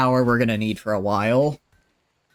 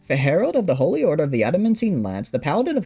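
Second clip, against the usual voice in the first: grand, rushed title recital, higher and louder; all in one breath; 6 semitones higher, 3 dB louder than usual. Pretend. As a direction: rattle off the royal titles without a breath. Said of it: "do it all in one breath".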